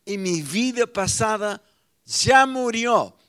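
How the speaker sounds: loud, rising, forceful sermon voice; fiery preaching. Acted. Pastor to his congregation, loud fiery preaching. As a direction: preach it out and hammer each ya.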